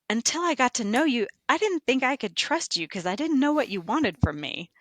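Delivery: a hint of the wary friend owning up; quoted coworker. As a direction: replay her frank admission in a lifted voice. Coworker's confession, voiced by teller.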